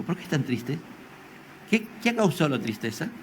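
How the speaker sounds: tender soft question. Acted. Jesus leans in to the sad disciples, soft. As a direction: ask it gently, close to them. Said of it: "Jesús se acercó y le dijo".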